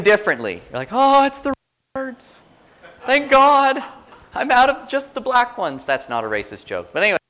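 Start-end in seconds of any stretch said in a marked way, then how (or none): 0.9-5.9 much higher, loud gushing relief; overjoyed reader squealing about red words; mock amazement. Bible reader gushing over Jesus' red words, mock ecstatic.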